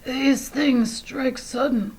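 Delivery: high, slow, soft disguised whisper; lion disguised as a sick lady whispering; faked whisper. A narrator's reading. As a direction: murmur it like a frail invalid. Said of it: "whispered Leo".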